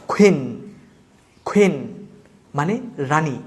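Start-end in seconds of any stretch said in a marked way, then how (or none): 0.1-2.1 "কুইন" with a puffed kh onset; aspirated q. Shown as a demonstration. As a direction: say "কুইন" as khuin. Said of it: "তাহলে আমরা এইভাবে উচ্চারণ করব". ("কুইন" শুরুতে খ উচ্চারণ)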